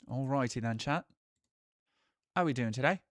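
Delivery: light mock British accent greeting chat; cheery mock British accent; put-on British accent. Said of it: "talking like this for the entire time".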